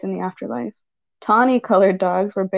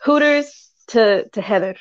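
playful drawl; 2 semitones higher, 3 dB louder than usual. Real, not acted. Punning Hooters to Heather.

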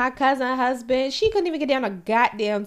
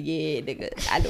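mock cool reply; 8 semitones lower, 5 dB quieter than usual. Acts out own cool reply.

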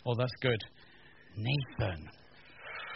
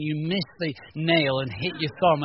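ordinary delivery

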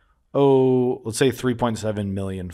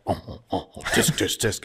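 tsk tsk disapproving; 4 semitones lower, 5 dB quieter than usual. Retold or imitated. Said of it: "we're like".

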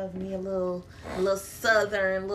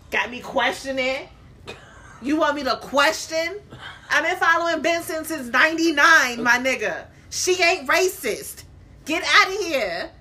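loud, high, indignant outburst; mad rant; 6 semitones higher, 5 dB louder than usual. Real, not acted. Defending a favorite show's star to the co-host, mad ranting. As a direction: rant in raised, incredulous pitch. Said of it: "She's mad. Usually it's me ranting".

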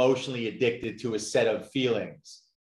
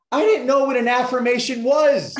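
much higher, louder, laughing through the words; 11 semitones higher, 6 dB louder than usual. Real, not acted. Guest laughing at himself to host Crystal, real laughter. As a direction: crack up at your own story.

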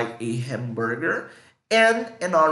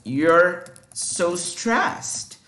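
slow example read; much slower than usual. Shown as a demonstration. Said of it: "For example".

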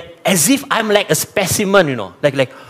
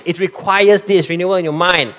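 normal talking voice

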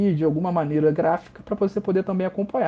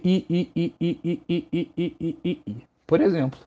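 rhythmic train chug on "I, I, I", fading softer; mock train noise, getting quieter; imitating a train; 3 dB quieter than usual. Shown as a demonstration. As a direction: chug it out like a departing train, trailing off. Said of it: "por exemplo, um trem partindo".

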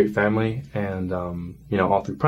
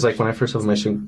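usual speaking voice

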